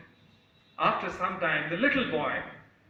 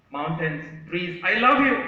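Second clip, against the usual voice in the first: top of voice; 2 semitones higher, 5 dB louder than usual. Acted. Little boy calls to mountains and trees, shouting. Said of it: "tell at the top of your voice".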